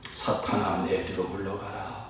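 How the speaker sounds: hushed, low, pained rebuke; heartbroken Jesus. Acted. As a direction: rebuke very softly, with a heavy heart. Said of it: "너무나 마음이 아프셨습니다". (마음 아픈 듯 낮고 작은 소리로 꾸짖음)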